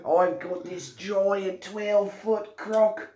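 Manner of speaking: high, excited Australian croc-hunter; broad Aussie accent, building to high delight; Steve Irwin. Acted. Host wrangling a giant croc, Steve Irwin impression. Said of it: "Steve Irwin".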